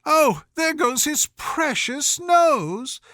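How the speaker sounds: high, slow, panicked cry; high frantic wail of alarm; terrified cry. A narrator's reading. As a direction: cry out as the nose nearly flies off. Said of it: "in an agony of terror".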